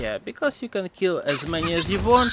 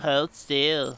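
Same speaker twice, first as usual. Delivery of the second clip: mock Russian accent. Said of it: "your Russian accent is hella fake".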